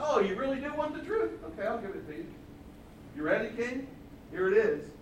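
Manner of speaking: high mock surprise, then dropping to matter-of-fact; sarcastic prophet. Prophet about to give king the truth, mock surprise. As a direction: feign surprise, then tease before delivering. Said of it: "That's sarcasm".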